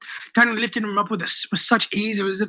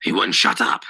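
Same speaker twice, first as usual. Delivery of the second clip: low-voiced retort; 11 semitones lower than usual. A narrator's reading. Daniel defends the killing.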